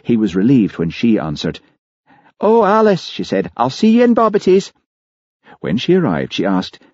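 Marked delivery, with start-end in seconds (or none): 2.4-3.1 Mary's voice. A narrator's reading. Mary voiced in narration. 3.6-4.7 Mary's voice. A narrator's reading. Mary voiced in narration.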